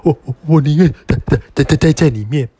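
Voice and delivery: fast frightened stuttering; scared stammer. Acted. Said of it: "小猪用害怕的声音说".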